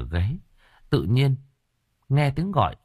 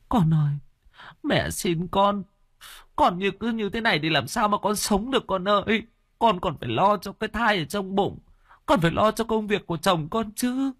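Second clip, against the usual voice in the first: much higher, wailing and pleading; wailing plea; 13 semitones higher than usual. Acted. Mother begs her collapsing daughter, high wailing plea. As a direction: beg through tears, voice pitched way up. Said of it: "tiếng khóc lóc của thím Nhàn cứ vang lên thất thanh ai oán".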